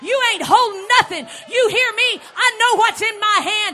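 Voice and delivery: loud, high defiant preaching; preaching at full force. Acted. Preacher defiantly shouting down the devil.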